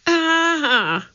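sudden high yelp of fright; startled cry. A narrator's reading. Jack touching something live in the water, high startled cry. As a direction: jump at a touch in the dark and cry out. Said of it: "he cried".

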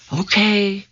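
sighing. A narrator's reading. Jack reluctantly agreeing to follow Annie, sighing. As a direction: give in with a sigh. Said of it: "Jack said with a sigh".

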